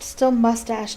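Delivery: ordinary delivery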